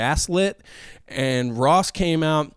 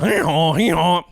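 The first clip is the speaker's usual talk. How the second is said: imitated two-note hee-haw; mock donkey hee-haw; donkey bray; 3 semitones higher, 3 dB louder than usual. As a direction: bray like a donkey standing in for a bike horn. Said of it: "That's a donkey".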